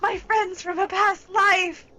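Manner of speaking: high, loud, teary greeting; overjoyed squeal. Real, not acted. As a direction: greet them like long-lost friends.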